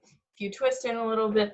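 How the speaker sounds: normal talking voice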